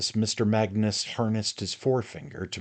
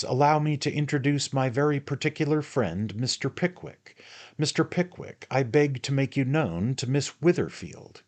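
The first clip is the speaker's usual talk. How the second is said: grand introduction; 8 semitones higher, 3 dB louder than usual. Acted.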